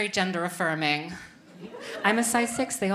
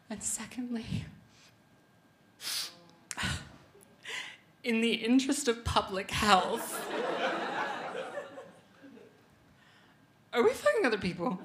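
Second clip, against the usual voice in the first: higher mock-weepy questioning, halting between phrases; acting tearful; 5 semitones higher than usual. Crying comedian powering through her second question, mock tearful. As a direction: push the question out through pretend tears. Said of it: "so I am powering through my questions".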